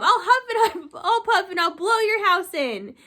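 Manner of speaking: wolf threat through giggles; laughing wolf. Acted. Teacher voicing the wolf to kids, laughing through it. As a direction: huff and puff while laughing.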